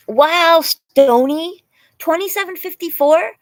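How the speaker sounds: high, loud excited exclaim; thrilled by a donation; loud high wow. Real, not acted. Reacting to big donation.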